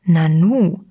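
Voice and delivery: mildly surprised exclamation; a touch of amazement, understated; astonished. A narrator's reading. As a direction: react as if taken aback. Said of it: "staunte er".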